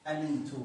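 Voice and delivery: أمنت said with a long drawn a; pronouncing the word. Shown as a demonstration. A teacher modelling أمنت with the long vowel. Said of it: "تصبح هكذا".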